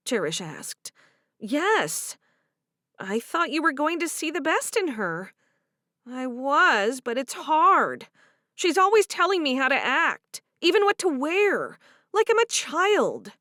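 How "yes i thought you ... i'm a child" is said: higher and louder, complaining; frustrated young wife. Acted. Favor complaining about Harriet, higher and aggrieved. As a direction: vent about the mother-in-law. Voiced character dialogue.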